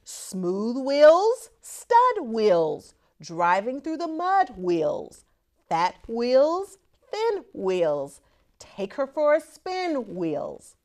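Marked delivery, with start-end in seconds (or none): none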